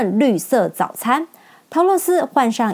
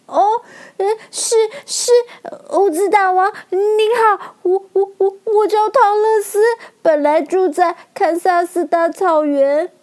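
音调很高、吞吞吐吐的小女孩; 战战兢兢地向大王自报家门; 害怕结巴; 10 semitones higher than usual. A narrator's reading. 吓坏的女孩向大王自报姓名. (害怕得结结巴巴)

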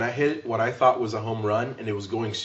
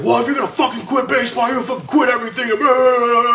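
high, loud furious father yelling; angry dad rant; 12 semitones higher, 5 dB louder, faster than usual. Retold or imitated. Dad yelling at his kid for quitting baseball, high furious rant. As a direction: blow up like the dad who lost it.

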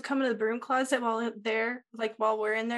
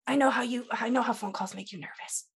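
ordinary delivery

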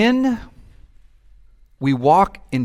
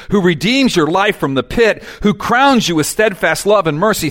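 loud, ringing scripture proclamation; full-voiced preacher reading; preaching proclamation; 4 semitones higher, 7 dB louder than usual. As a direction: proclaim the psalm to the church. Proclaiming psalm to congregation.

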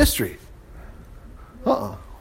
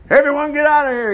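higher-pitched command to clear the room; commanding order; 8 semitones higher than usual. A narrator's reading. Ammon sends his servants out of the room, commanding. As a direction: call out an order to everyone.

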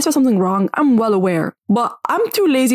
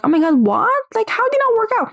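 mock shock; 14 semitones higher than usual. Judgy person reacting to someone who doesn't work out, in a high mock shriek. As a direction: squeal it in exaggerated shock. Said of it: "And you're like".

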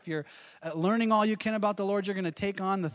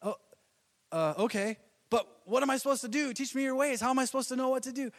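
higher, anxious pleading; pleading Moses; 7 semitones higher than usual. Retold or imitated. Moses coming back pleading with God. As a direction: fret and beg for direction. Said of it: "imagine Moses coming back and saying".

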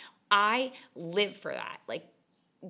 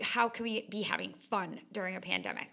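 normal talking voice

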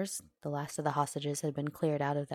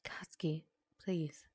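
near-whispered, tender pleading; hushed plea; 4 dB quieter than usual. A narrator's reading. Uraraka pleads with sobbing Katsuki, hushed. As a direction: coax gently, barely above a whisper.

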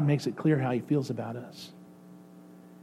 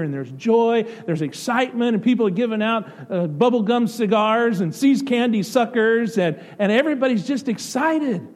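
excited; 7 semitones higher, 3 dB louder than usual. Real, not acted. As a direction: get swept up in the celebration. Describing celebrating a new baby.

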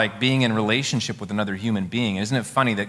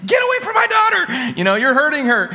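much higher, louder protective shout; frantic mother's yell; 7 dB louder than usual. Retold or imitated. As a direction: yell it like a mother defending her baby. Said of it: "She's like".

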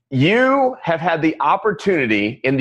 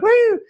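mimicked whoop, pitched way up; high whooping "woo"; 4 dB louder than usual. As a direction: let out a playful high whoop. Mimics joy of plane swerving.

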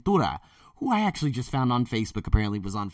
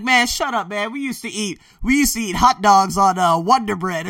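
lightly voiced friend, higher and louder, teasing; mock friend teasing; 9 semitones higher, 9 dB louder than usual. Carlos teasing him about eating hot dogs on Wonder Bread, higher and louder. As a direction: playfully rib him like an old buddy. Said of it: "he's like".

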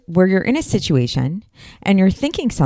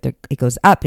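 ordinary delivery

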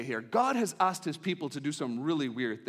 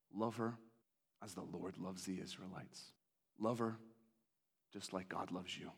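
much lower, soft and tender; God's gentle command; 11 semitones lower, 12 dB quieter than usual. Acted. God tells Hosea to love his wife again, soft and tender. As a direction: speak love over him softly. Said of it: "and says in chapter three".

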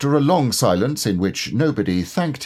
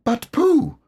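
much higher, eager squeak; excited Piglet. A narrator's reading. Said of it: "cried Piglet, all excited".

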